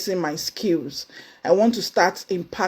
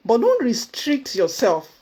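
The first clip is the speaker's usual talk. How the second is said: ordinary delivery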